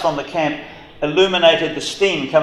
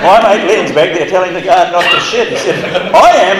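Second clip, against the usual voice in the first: retelling it through real laughter, rising louder; laughing; 4 semitones higher, 6 dB louder than usual. Retold or imitated. Friend recalling the escape, laughing at his mate's words. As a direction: laugh out the punchline. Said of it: "I've burst into laughter".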